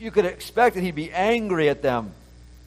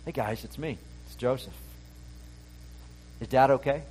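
soft, tender and much quieter, hey guys it's me; gentle reveal; 8 dB quieter than usual. Shown as a demonstration. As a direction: reveal yourself gently to long-lost brothers. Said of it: "I think it's like".

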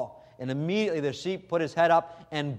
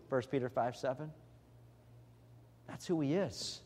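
hushed intimate; 9 dB quieter than usual. Acted. Intimate turn after loud climax.

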